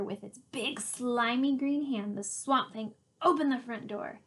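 ordinary delivery, nothing marked

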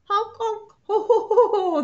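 loud high honking laugh; honking gander laugh. Acted. Gander mocks Hedgie with loud honking laughter. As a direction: honk and guffaw at him. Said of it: "the gander laughed".

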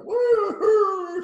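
high loud playful howl "Woo-hoo"; mock dog howl. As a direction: howl like a lonely puppy. Dog howling at the door.

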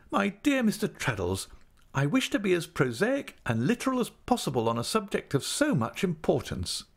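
a hint of a grand, fussing wife; Mrs Micawber voice. A narrator's reading.

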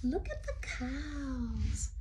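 hush, point them out in a whisper. Real, not acted. Showing children the cows in the picture, whispering.

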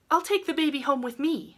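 high, sudden resolve; impulsive girl. A narrator's reading. Rilla decides to take the baby, impulsive. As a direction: blurt the decision in a high voice.

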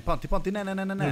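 high, loud frantic calling of Panti's name; urgent yell. Real, not acted. To teammate Panti stepping out, a high frantic shout. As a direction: stop him before he walks out, shouting.

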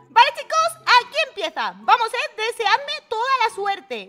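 high, loud burst of hype; loud excited call to the chat. Real, not acted. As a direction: fire up the viewers as the round starts. Excited start of the round.

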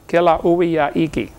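Kilauea Iki said slowly, each vowel sounded out; every vowel pronounced. Shown as a demonstration. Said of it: "They pronounce every vowel".

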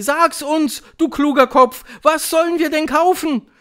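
high, loud pleading; calling crowd. A narrator's reading. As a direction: shout eagerly for the answer. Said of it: "riefen sie". (rufende Menge, hoch und laut)

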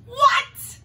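high loud What; shocked squeak. Real, not acted. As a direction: squeal in disbelief. Shocked at the song.

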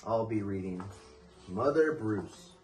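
ordinary delivery

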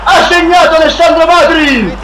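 rising goal call, shouted; breathless italian goal commentary. Acted. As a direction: yell the goal name over and over. Goal scored in FIFA match.

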